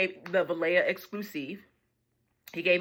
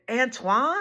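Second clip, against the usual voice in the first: louder American "Antoine" with a questioning rise; American accent; 7 semitones higher, 4 dB louder than usual. Retold or imitated. Customer checking the Frenchman's name, questioning American accent. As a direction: repeat the name back higher, in an American accent. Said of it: "he said it much more".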